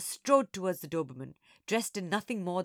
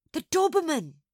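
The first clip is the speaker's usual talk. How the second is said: high, loud cry of dread; alarmed outburst, pitched way up; chorused alarm; 10 semitones higher, 8 dB louder than usual. A narrator's reading. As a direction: exclaim in shocked chorus. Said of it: "Monkey and I chorused".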